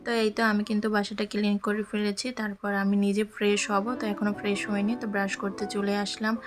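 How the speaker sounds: ordinary delivery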